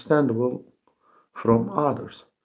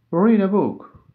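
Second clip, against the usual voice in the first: higher and louder, READ punched hard; loud model reading; 7 semitones higher, 5 dB louder than usual. Shown as a demonstration. Teacher repeats the example loudly for learners. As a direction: call it out with energy. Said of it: "For example".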